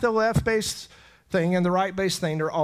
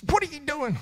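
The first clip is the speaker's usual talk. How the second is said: mock scolding; 10 semitones higher than usual. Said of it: "I was like".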